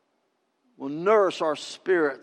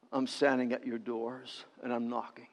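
low, very soft closing plea; hushed appeal; 8 semitones lower, 13 dB quieter than usual. Acted. The Lord at the church's doors, hushed appeal. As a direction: drop to a quiet, low murmur.

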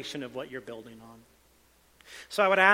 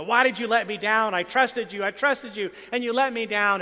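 higher, louder mock indignation; angry complaint; 6 semitones higher, 5 dB louder than usual. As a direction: act out an aggrieved protest to God. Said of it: "just anger and says".

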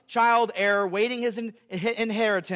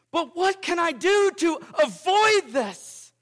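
higher, slow mock terror; 8 semitones higher, 3 dB louder, slower than usual. Preacher as sinner begging the law, in mock terror. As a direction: plead slowly in staged fear. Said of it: "I reply in terror".

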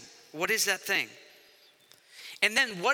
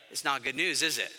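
ordinary delivery

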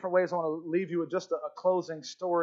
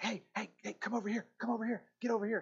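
hushed, quick whisper to get attention; urgent whisper; 4 semitones higher, 8 dB quieter, faster than usual. Retold or imitated. One prisoner secretly calling the doctor over, whispering. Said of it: "he's whispering to him".

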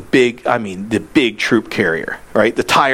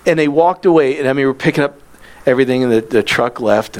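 ordinary talk, nothing marked

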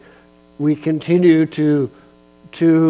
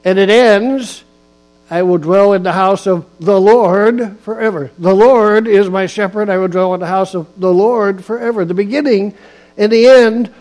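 louder emphatic preaching with punched words; 3 semitones higher, 4 dB louder than usual. Acted. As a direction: drive each phrase home to the pews. Preaches on Psalm 23.

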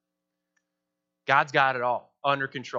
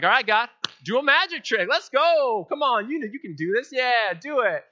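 higher and louder cheeky challenge; mock impatient demand; 8 semitones higher, 4 dB louder than usual. Believers daring God, a high cheeky taunt. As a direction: egg God on like a street magician.

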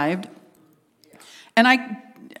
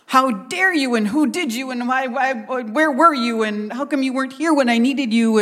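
high, piled-up accusations, acted; hammed-up angry tirade; blasting a loved one; 5 semitones higher than usual. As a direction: fire indignant questions one after another. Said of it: "and we just blast them".

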